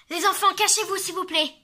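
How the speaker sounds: high, loud urgent plea; panicked warning. Acted. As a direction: warn them in panic. Godfather warns children.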